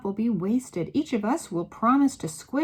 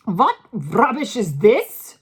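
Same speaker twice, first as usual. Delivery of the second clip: slow, loud scornful shout; angry shout; 3 semitones higher, 12 dB louder, slower than usual. A narrator's reading. Grand High Witch scolding the assembly, slow angry shout. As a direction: spit it out in contempt. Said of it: "shouted the Grand High Witch".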